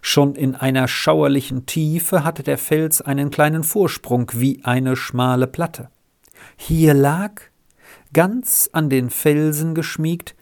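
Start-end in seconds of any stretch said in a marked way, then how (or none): none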